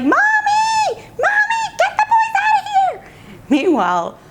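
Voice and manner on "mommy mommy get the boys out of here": put-on very high, squealing kid shout for mommy; imitated daughter's yell. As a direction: holler for mom like an exasperated little girl. Daughter calls mother for help.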